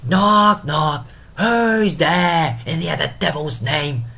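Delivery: loud, high theatrical shout at the door; calling out. Acted. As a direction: bellow like a drunken gatekeeper demanding who knocks. Porter calls out to knockers.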